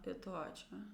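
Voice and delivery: very soft, low, without any intonation; lifeless reply. Acted. Learner answers a greeting terribly, soft and lifeless. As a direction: answer as if drained of life. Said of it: "Terrível, né?".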